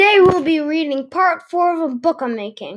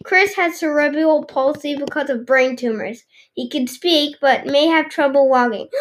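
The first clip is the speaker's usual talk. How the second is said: usual speaking voice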